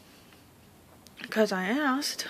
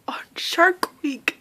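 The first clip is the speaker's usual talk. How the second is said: hushed thrilled whisper; breathy excited hush; 5 semitones higher, slower than usual. Real, not acted. Excited about scale drop.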